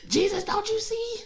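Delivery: high, teary mock wail; hammed-up sob of complaint; mock whiny cry. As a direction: whine it through laughter like a crybaby. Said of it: "I'm still laughing at myself".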